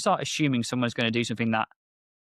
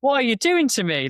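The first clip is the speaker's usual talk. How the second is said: much higher, louder mock exasperation; recreating yelling at the AI, higher and louder; exasperated outburst; 12 semitones higher, 6 dB louder, slower than usual. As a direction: throw it at the screen in exasperation. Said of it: "And I'm like".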